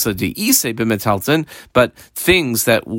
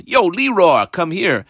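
higher, casual call across the room; friendly higher shout to come over; 7 semitones higher, slower than usual. Retold or imitated. Teacher voices calling a slave.

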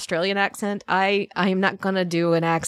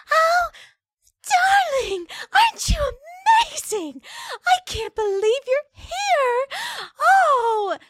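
very high, fluttery cartoon villainess; sugary, squeaky-high and slow; Fluttershy meets Harley Quinn; slower than usual. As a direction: gush in a tiny falsetto, drawn out. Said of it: "it's like Fluttershy meets Harley Quinn".

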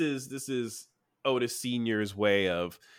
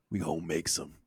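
mock hushed scheme; 9 semitones lower, 10 dB quieter than usual. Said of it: "he's like".

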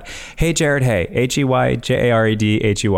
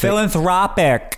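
Philanthropic in a thick Long Island accent, higher and slow; 9 semitones higher than usual. Shown as a demonstration. [name] showing off his Long Island accent on the word. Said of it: "In a Long Island accent for you".

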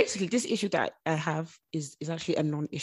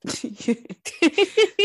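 high rising laugh; loud laugh; 10 semitones higher, 8 dB louder than usual. Real, not acted. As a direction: burst out laughing. Laughing at the joke.

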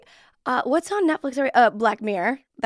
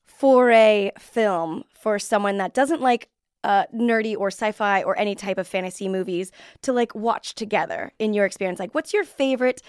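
ordinary talk, nothing marked

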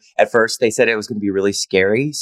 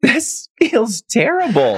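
mock dismay; slower than usual. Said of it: "I was like".

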